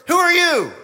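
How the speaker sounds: loud, high-pitched demand; indignant challenge. Acted. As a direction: throw it out as an outraged challenge. Voicing the challengers' blunt question.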